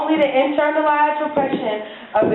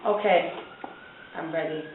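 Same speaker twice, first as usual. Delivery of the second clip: very soft, low lover's voice; hushed and low, with small pauses; quiet, solemn readiness; 8 semitones lower, 8 dB quieter, faster than usual. Acted. Bob before looking at his partner, hushed and solemn.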